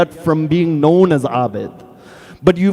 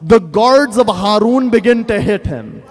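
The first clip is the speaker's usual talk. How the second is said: ordinary talk, nothing marked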